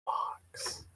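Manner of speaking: hushed mock whisper. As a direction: whisper it like a secret. Teasing friend about the hotbox.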